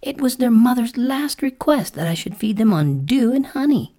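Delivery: high-pitched indignant protest; offended caterpillar, voice pitched well up. A narrator's reading. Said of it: "said the caterpillar indignantly".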